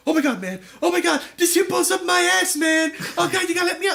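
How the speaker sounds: loud, high-pitched frantic pleading; hammed-up terror, begging to get out; comic panic. Comic victim chased by a hungry hippo, panicking.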